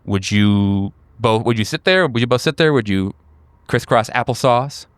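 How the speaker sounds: normal talking voice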